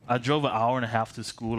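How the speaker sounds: ordinary talk, nothing marked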